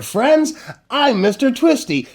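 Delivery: plain reading voice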